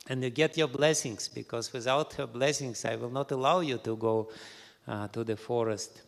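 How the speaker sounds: ordinary delivery, nothing marked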